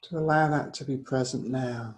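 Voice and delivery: unhurried yoga nidra guidance; guided meditation. Real, not acted. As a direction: guide them gently inward, leaving space between phrases.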